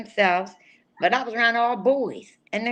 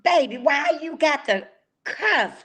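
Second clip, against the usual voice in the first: slow, higher, drawn-out country mama scolding; country mom; 6 semitones higher, slower than usual. Retold or imitated. Retelling his mother's late-night phone scolding, slow and higher. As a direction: imitate a country mother chiding her son, slowly. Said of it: "you know, she's country".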